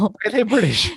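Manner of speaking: clumsy put-on British accent; bad British accent. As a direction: attempt a posh British accent, badly. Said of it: "I'm terrible at accents".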